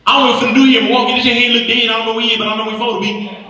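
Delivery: put-on high, fast, slurred Atlanta kid; Atlanta twang. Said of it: "He talks in cursive".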